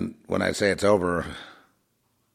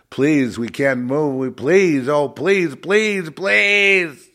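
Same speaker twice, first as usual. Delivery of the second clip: pleas climbing higher and louder; mock desperate begging; 7 semitones higher, 6 dB louder, much slower than usual. Fake-church preacher begging for revival, mock desperate pleading. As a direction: build to a hammed-up wailing plea. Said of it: "they're begging and begging and they're crying".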